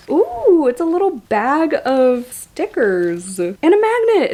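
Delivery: high, delighted ooh and lifted voice; excited discovery. Real, not acted. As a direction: coo loudly over a little surprise. Delighted discovery while unboxing gifts.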